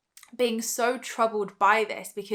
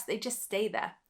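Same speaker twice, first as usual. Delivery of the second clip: usual speaking voice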